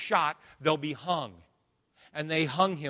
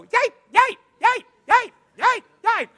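high, squeaky yip yip of a tiny dog; yapping little dog; 10 dB louder than usual. Retold or imitated. Preacher mocking little mop dogs yapping. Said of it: "most mop dogs I know".

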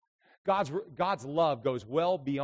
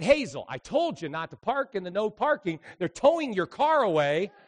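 raised, higher voice calling her out; mock scolding; 7 semitones higher, 9 dB louder than usual. Pastor warning Hazel her car is being towed, mock scolding. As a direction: scold her loudly for show.

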